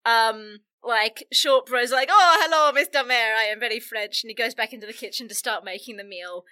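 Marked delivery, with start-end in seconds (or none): 2.1-4.2 fake French accent. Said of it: "he does, like, the fake French accent".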